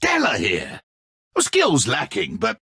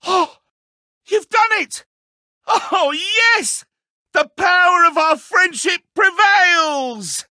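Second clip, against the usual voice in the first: mock-excited cheer; 12 semitones higher, 3 dB louder than usual. Mock celebration of winners.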